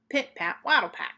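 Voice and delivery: usual speaking voice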